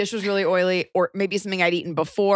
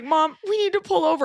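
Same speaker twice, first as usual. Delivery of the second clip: urgent plea; 10 semitones higher than usual. Retold or imitated. Panicked plea in car.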